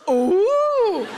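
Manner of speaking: loud, swooping "ooh"; intrigued ooh. Acted. Comic recalling hearing a shouted suggestion, loud mock-intrigued ooh. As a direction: let out a big rising-and-falling ooh. Acted reaction to heckled suggestion.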